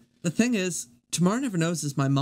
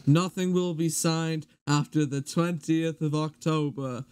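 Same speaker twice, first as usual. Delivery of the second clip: memorised video recital. Acted. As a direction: deliver it as a ponderous public announcement. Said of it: "I've memorized this".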